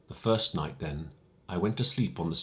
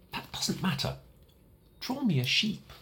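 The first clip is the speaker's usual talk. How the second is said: put-on high, hushed child's voice; high little prince, half whispered then insistent; 11 semitones higher than usual. Little prince answers pilot.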